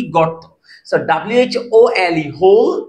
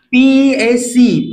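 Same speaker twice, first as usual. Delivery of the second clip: slow spelling; 4 semitones higher, 4 dB louder, much slower than usual. Shown as a demonstration. Teacher spelling peace for students, slowly. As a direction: spell PAC letter by letter, very slowly. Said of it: "উচ্চারণ একই".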